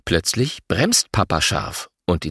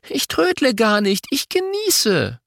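indignant; 13 semitones higher than usual. A narrator's reading. Twin protests being called slow.

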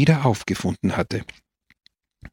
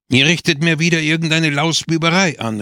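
higher and louder accusing call; angry cry; 6 semitones higher, 3 dB louder than usual. A narrator's reading. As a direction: call out the suspicion angrily. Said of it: "rief er". (empört gerufen)

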